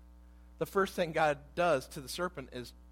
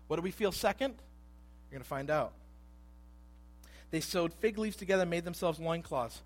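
normal talking voice

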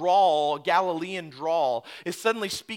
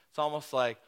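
ordinary delivery

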